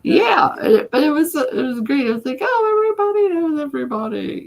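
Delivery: high, loud, bubbly; excited. Real, not acted. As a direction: gush about it excitedly. Excited retelling, laughing.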